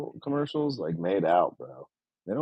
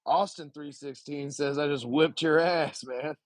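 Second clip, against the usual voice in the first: lightly gruff Austin impression, a bit higher; Stone Cold Austin promo; 6 semitones higher than usual. To the chat, a light Stone Cold impression. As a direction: strut through it like a wrestling promo. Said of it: "do the Austin promo".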